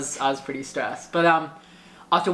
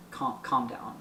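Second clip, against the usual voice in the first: soft, hushed reassurance; imitating a calm driver, voice dropped; soothing; 3 semitones lower, 8 dB quieter than usual. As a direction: quietly settle someone who is freaking out. Retells driver soothing freaked-out passenger.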